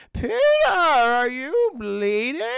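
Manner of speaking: hammed-up alarmed aunt; worried aunt. Aunt noticing Peter's bloody sleeve, hammed-up alarm.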